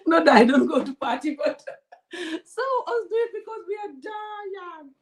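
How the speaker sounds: laughing through the words, high. Real, not acted. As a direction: laugh while admitting it. Laughing over party life.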